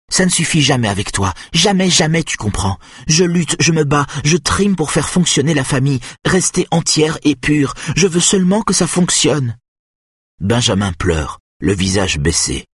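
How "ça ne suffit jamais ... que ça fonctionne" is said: high-pitched, exasperated tirade; rugissement exaspéré. A narrator's reading. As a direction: rail at him in a raised, pressing voice. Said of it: "rugit-elle". (tirade furieuse, voix montée dans l'aigu)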